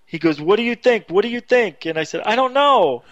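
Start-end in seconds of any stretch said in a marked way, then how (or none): 0.4-1.8 higher, insistent imitation of dad; acted agitation, pitched up; urgent questioning. Dad's frantic questions in retold call. 2.2-3.0 high-pitched acted protest; retold exasperation, pitched up; flustered reply. Flustered answer in retold frantic call.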